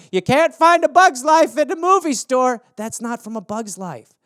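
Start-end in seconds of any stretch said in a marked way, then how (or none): 0.1-2.6 pitched-up quoting voice; hammed-up line delivery; mock movie quote. A guest misquoting a movie, pitched up. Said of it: "It's like they say in".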